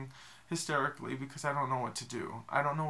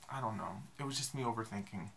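ordinary delivery

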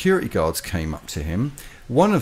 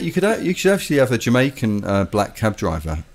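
ordinary delivery

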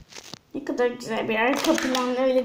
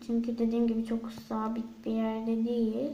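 ordinary talk, nothing marked

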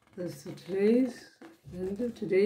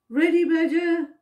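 higher, louder call across; 7 semitones higher, 5 dB louder than usual. A narrator's reading. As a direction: call over to the badger. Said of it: "called Percy".

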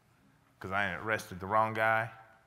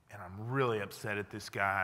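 ordinary talk

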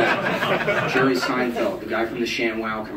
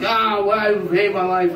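mock anguished yell; 8 semitones higher than usual. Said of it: "screaming at the top of my lungs".

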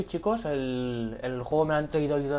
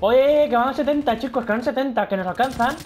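loud, high, alarmed call to the viewers; panicked warning; 5 semitones higher, 5 dB louder than usual. Real, not acted. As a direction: warn them the enemy is closing in. Rival team catching up.

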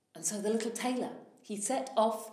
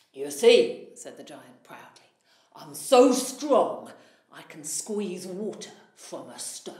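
louder, puffed-up giant boast; proud giant; 3 semitones lower, 9 dB louder than usual. Acted. Giant boasting his strength.

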